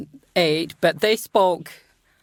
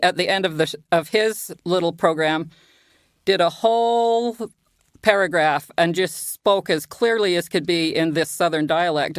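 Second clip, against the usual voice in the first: ordinary talk, nothing marked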